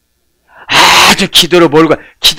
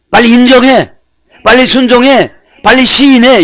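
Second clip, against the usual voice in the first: urgent command; 7 semitones higher, 4 dB louder than usual. Retold or imitated. God urging people, voiced.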